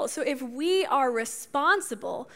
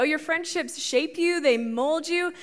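ordinary talk, nothing marked

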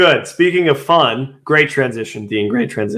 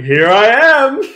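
imitating Sunny Day; 4 semitones higher, 6 dB louder than usual. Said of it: "the clip of Sunny Day saying".

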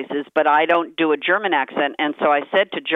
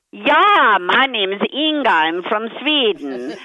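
higher, louder Swedish Inga; Swedish accent; 6 semitones higher, 4 dB louder than usual. Retold or imitated. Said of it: "do it Swedish".

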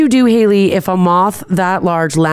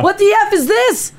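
high startled yell; retold scream. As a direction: shriek it in a panic. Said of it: "I screamed so loud".

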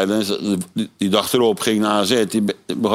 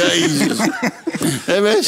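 laughing call; 9 semitones higher, slower than usual. Real, not acted. Laughing, calls out to Wes.

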